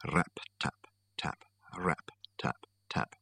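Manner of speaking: hushed, unvoiced taps; whispered tapping. A narrator's reading. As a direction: whisper it like fingers drumming softly. Narrator imitates tapping.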